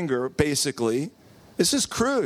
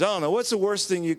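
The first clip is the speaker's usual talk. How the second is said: normal talking voice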